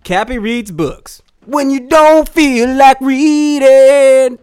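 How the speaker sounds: slow, loud shouted slogan. Acted. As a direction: belt out the tagline slowly.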